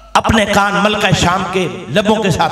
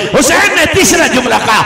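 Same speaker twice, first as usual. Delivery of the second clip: ordinary talk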